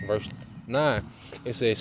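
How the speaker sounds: ordinary delivery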